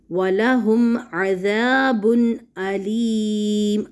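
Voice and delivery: slow recitation stopping on عَلِيمٌ; drawn-out waqf ending; recitation with pause. Shown as a demonstration. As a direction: recite وَلَهُمْ عَذَابٌ عَلِيمٌ, then pause on the final word. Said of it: "اگر ہم وقف کریں".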